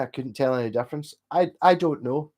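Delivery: usual speaking voice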